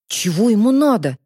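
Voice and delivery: plain reading voice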